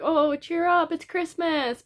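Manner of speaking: imitating chirpy well-wishers; mock cheery. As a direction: parody the relentlessly upbeat advice. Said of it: "Everyone's like".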